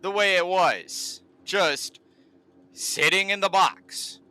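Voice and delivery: annoyed, rising, stretched-out complaining; frustrated rant. Real, not acted. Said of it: "this is actually kinda getting frustrating".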